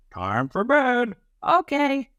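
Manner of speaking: put-on high, slow parent then child's okay; bedtime exchange voices.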